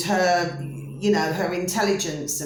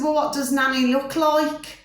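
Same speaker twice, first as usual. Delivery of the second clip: put-on careful question to a child; 8 semitones higher than usual. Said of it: "I said, I said".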